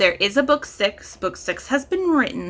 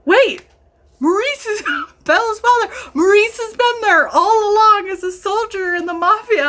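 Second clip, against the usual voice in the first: high, loud, laughing disbelief; shocked realization; 11 semitones higher, 4 dB louder than usual. Retold or imitated. Reader realizing a twist, high excited squeal. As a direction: squeal it out as it clicks.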